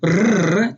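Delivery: rrr as a tongue-tip trill at the front of the mouth; Spanish rolled R. Shown as a demonstration. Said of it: "Not the same as the Spanish roll".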